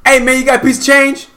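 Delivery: high, loud, fast holler; imitating a street shout, loud and high-pitched; yelled across street. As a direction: call out to someone across the street. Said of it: "He yells from across the street".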